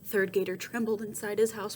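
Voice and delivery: ordinary delivery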